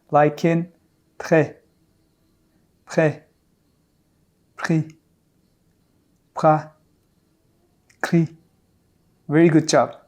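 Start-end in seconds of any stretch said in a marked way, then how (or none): 1.2-8.3 prêt, pris, cri with a French throat r after the consonant; uvular r. Shown as a demonstration. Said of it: "the letter R comes after the first consonant".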